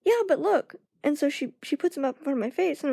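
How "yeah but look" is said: higher, insistent friend voice; friend insisting. Retold or imitated. Friend pushing her nails forward, higher and insistent. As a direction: insist they look. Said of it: "She was like".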